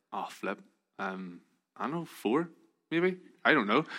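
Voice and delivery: soft, hesitant guess, pausing; unsure. Retold or imitated. Answering friends about Northern Ireland's population, mock unsure. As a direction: re-enact fumbling for an answer.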